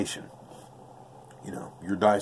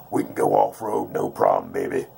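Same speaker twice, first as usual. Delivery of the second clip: deep mock voice; 13 semitones lower than usual. Joking about bus going off-road.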